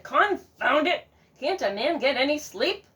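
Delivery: loud, irritated grumble; grumpy farmer. Acted. Woken farmer scolding the goose, irritated.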